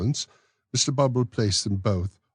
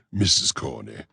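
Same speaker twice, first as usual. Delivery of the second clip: knowing smile; 6 semitones lower, 4 dB louder than usual. Acted. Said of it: "smiling as men smile who are conscious".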